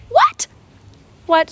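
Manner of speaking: very high, loud squeak of disbelief; incredulous. Acted. Student facing another school year, squeaky mock disbelief. Disbelief at being held back.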